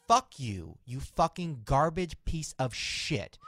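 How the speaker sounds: loud, slow, snarled insult; imagined tirade. Retold or imitated. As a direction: spit it out slowly like she's trash. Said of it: "I'd be like".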